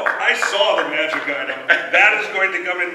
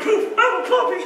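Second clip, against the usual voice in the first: excited puppy; 4 dB louder, faster than usual. Acted. Druid turning into puppy.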